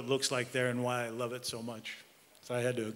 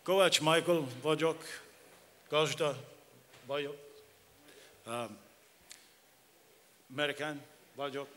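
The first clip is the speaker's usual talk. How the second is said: American accent Hungarian. Real, not acted. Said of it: "That's all of my Hungarian".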